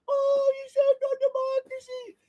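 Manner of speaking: slow, high, soft swooning falsetto; mock adoring celebrity voice; mocking Ben Stiller. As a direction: sigh it out like a gushing fan. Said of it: "Ben Stiller".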